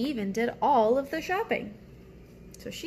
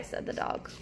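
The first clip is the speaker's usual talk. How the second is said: sleepy whisper; 6 dB quieter than usual. Acted. Sleepy dog tag whispered.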